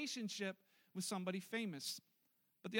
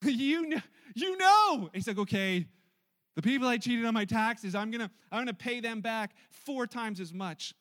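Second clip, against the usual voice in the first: caught out; 10 dB louder than usual. Acted. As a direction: cover the slip loudly, then promise. Zacchaeus caught out, sheepish.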